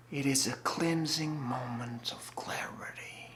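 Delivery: soft, half-whispered awe; quietly entranced; hushed revelation. Acted. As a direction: let the words drift out on breath. Monologue of rapt spiritual revelation.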